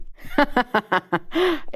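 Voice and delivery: real burst of laughter. Real, not acted. Reader cracking up after the sweet dialogue, laughing. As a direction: break out laughing.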